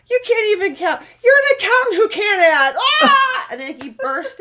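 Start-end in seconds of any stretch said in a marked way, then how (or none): 0.1-2.7 much higher, louder, cracking with laughter; laughing mock insult. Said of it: "all I heard was Noah say". 3.0-3.5 high loud laughing shriek; laughing yelp. Retold or imitated. Burst of laughter retold.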